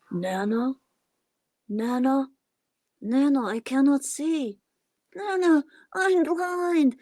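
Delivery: a helpless little puppet calling for help; childlike puppet calling. Pretend.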